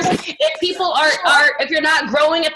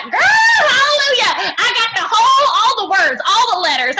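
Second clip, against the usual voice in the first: gushing over-eager reader; 6 semitones higher, 3 dB louder than usual. Pretend. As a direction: gush like a thrilled bookworm, loud and high.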